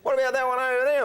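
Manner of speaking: fast, high-pitched retelling; acting himself out. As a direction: re-enact your eager question quickly. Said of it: "and I go".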